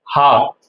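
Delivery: "हा" as a breath h and long ah; German letter H. Shown as a demonstration. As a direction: model German H as "हा". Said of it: "German की बात करूं तो इसको बोलते हैं". (जर्मन अक्षर H का "हा" उच्चारण)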